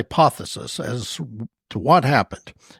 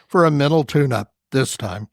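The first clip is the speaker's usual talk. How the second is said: ordinary delivery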